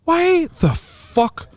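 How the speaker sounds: very high, drawn-out disbelief; incredulous outburst. Real, not acted. Host interrupting a stranger's medical confession, high incredulous outburst. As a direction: stretch each word in high-pitched disbelief.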